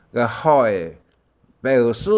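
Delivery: normal talking voice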